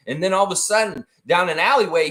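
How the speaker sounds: normal talking voice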